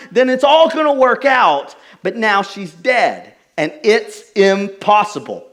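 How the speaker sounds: preacher voicing the mourners as hope collapses. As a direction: act out the mourners' grief, slowing at the end. Voicing the grieving crowd.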